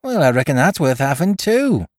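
high, pleased pirate voice; relieved, eager delight; sort of joy. A narrator's reading. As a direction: cheer up and end on a high lift. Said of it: "cried Dick with a sort of joy".

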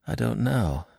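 mildly weary reply; a touch of weariness, falling away; a little weary. A narrator's reading. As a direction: answer with tired resignation. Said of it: "I said a little wearily".

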